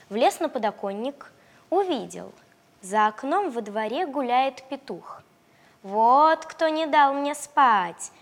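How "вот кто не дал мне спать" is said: slow, louder little puppy; puppy grumbling slowly. Acted. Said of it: "подумал щенок".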